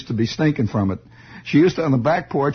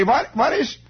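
high, puzzled mock German accent; fail to understand, in a put-on German accent; baffled German accent; 10 semitones higher than usual. Mister Falpo not understanding regular talk, baffled German accent. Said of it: "He could not understand regular talk".

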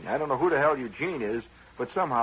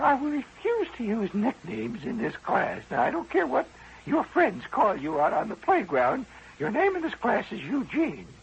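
strict teacher; 10 semitones higher than usual. Retold or imitated. Teacher refusing the boy's nickname, high and prim. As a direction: lay down the rule like a stern teacher.

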